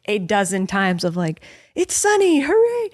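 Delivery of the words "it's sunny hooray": a much higher, sing-song hooray; mock cheer. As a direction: imitate kids cheering for sunshine. Imitating kids cheering sun.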